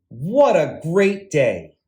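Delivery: usual speaking voice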